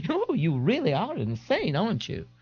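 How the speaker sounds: camp mock alarm. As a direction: play shocked for fun. Young man teasing.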